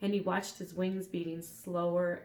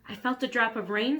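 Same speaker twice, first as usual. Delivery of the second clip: put-on higher boy voice; 4 semitones higher, 7 dB louder than usual. A narrator's reading. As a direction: voice Elmer, higher. Elmer voiced.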